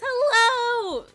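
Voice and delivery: high, loud greeting whose voice breaks; cracking hello. Real, not acted. Greeting a viewer with a hoarse voice, cracking excited hello. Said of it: "my voice is gonna keep cracking".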